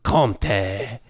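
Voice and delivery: cheese name in a put-on French accent; mock French accent. Reading obscure French cheeses for a joke, mock French accent. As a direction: say it like a hammy Frenchman. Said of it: "names of some obscure French cheeses".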